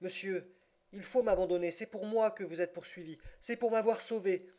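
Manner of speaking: plead, trying in vain to hide the emotion; poorly hidden emotion. A narrator's reading. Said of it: "voix dont elle cherchait en vain à déguiser l'émotion". (voix aiguë, émotion mal déguisée)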